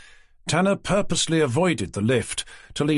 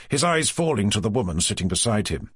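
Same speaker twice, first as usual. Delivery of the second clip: usual speaking voice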